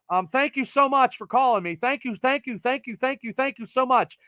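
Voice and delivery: high, repetitive over-grateful patter; hammed-up gratitude to a cold caller; mock gushing thanks.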